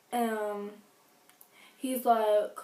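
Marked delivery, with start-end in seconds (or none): none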